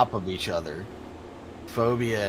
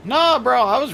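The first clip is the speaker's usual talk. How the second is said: loud protest; 13 semitones higher, 7 dB louder than usual. Real, not acted. Protests accusation of dirty racing.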